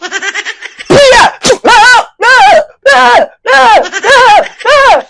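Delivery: high shrieking laugh. Real, not acted. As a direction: howl with laughter. Big laugh.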